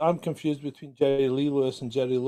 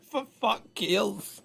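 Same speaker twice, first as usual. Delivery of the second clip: high unvoiced laugh into slow words; 7 semitones higher, 4 dB quieter, slower than usual. Real, not acted.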